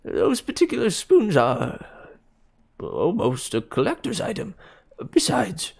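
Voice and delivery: a hint of a jittery old man; nervous. A narrator's reading. Nervous dealer.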